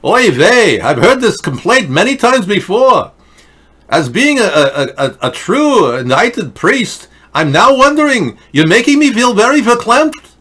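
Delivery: put-on high, loud Yiddish accent.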